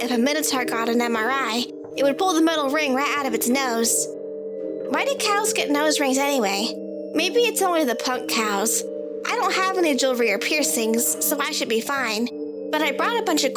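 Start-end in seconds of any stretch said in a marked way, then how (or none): none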